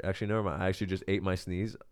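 ordinary delivery

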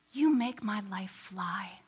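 slow, hushed whisper; whispered line. Retold or imitated. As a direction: breathe it out like a secret. Larry proposing on plane.

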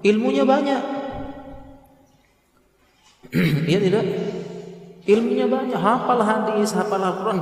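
emphatic preaching. Real, not acted. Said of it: "preacher rises to full force".

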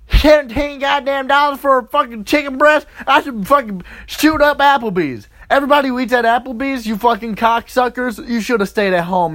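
high, rushed angry tirade; outraged rant. Acted. Comic raging at Applebee's prices, worked-up outrage. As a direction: rant as if boiling over at the prices.

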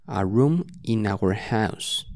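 A room in our house said slowly; slow model sentence. Shown as a demonstration. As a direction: read it slowly for learners. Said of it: "una oración que dice".

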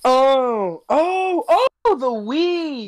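high drawn-out oh of realization; sudden realization. Real, not acted. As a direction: stretch the oh as it dawns. Realizing it's the Wii.

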